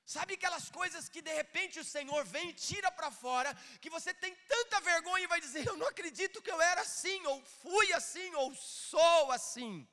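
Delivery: high, loud preacher's proclamation; impassioned preaching. Acted. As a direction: preach at full force, pushing the key words. Preaches at full force.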